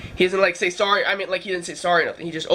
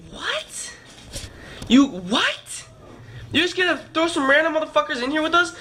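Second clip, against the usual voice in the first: incredulous what; 8 semitones higher than usual. Retold or imitated. To the host about strangers in the room, shocked and indignant. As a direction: throw the words up in outraged disbelief.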